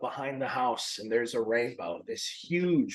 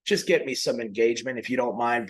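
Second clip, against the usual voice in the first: ordinary talk, nothing marked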